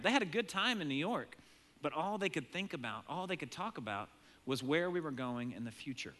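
normal talking voice

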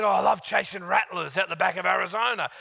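lightly voiced Australian snake expert; Australian accent. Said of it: "He said".

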